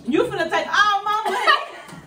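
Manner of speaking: high laughing exclamation. Real, not acted. Laughing while praising the taste.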